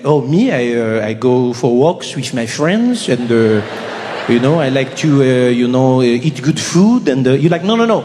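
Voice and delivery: lightly voiced Frenchman shrugging off the question; French accent. A Frenchman describing his life, in a lazy French accent. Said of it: "Be like".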